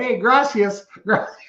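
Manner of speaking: high mock Southern tourist voice. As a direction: play the clueless tourist thanking in Spanish. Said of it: "it was not gracias".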